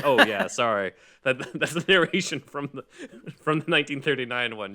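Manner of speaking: light embarrassed chuckle while explaining; sheepish laughing apology. Retold or imitated. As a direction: laugh off the mistake. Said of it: "And I'm like".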